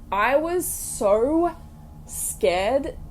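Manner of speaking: usual speaking voice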